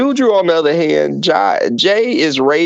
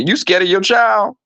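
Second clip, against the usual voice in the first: normal talking voice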